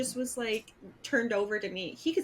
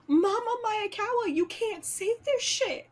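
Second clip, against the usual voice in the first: higher-pitched mock scolding; imitated friend's protest; 8 semitones higher than usual. Friend scolding mom over FaceTime, high-pitched mock protest.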